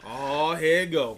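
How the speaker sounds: ordinary talk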